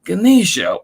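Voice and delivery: usual speaking voice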